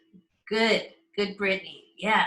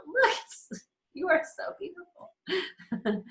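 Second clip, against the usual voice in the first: much higher, slow and tender; tender awe; 10 semitones higher, much slower than usual. Acted. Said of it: "And I get to go".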